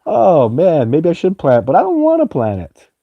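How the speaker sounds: high, whining mock protest; squeaky, sulky refusal; playful whine. Real, not acted. Still refusing the acorn advice.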